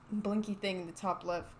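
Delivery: normal talking voice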